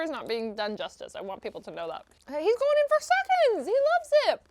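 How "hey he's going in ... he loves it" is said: high-pitched excited commentary; excited squeal. Real, not acted. Watching a friend go in for seconds, excited squeal. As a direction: squeal it like cheering someone on. Cheering on the eater.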